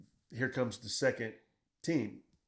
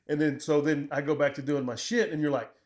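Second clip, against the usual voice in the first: ordinary talk, nothing marked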